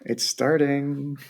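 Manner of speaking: normal talking voice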